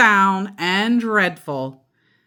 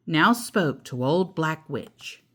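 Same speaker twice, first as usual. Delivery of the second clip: plain reading voice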